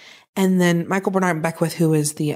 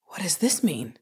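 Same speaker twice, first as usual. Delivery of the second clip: whispering; 2 semitones lower, 6 dB quieter than usual. Retold or imitated. Retold confusion.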